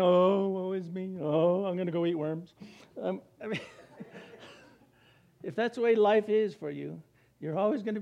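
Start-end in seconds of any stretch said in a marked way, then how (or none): 0.0-2.5 mock self-pity. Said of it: "If you walk around all the time".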